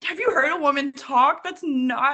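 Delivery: high-pitched, disbelieving challenge; mock incredulity. Listener challenging a man's attempt at female voices, mock incredulity. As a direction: protest as if baffled by the narrator.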